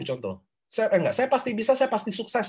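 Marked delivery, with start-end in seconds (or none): 1.2-2.5 high-pitched sample mantra, not shouted; example affirmation. Shown as a demonstration. Coach giving students a sample affirmation, high-pitched recital.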